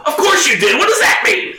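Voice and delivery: fly off the handle in a squeal; mock shriek. Reading a film quote on the podcast, shrieking.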